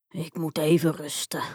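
low, lightly voiced weary giant; tired giant. A narrator's reading. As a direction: sigh it out as a worn-out giant. Worn-out giant sits down to rest. (lichtjes vermoeide, lage reuzenstem)

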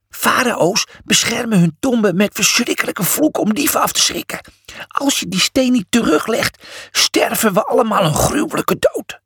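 high, dread rising; fearful warning. A narrator's reading. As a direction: plead in fear. Cornelius fearful.

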